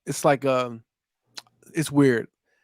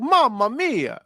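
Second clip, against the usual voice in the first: high, hammed-up Mario exclamation; Italian accent; 9 semitones higher, much slower than usual. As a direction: throw it up high like cartoon Mario. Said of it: "he was like".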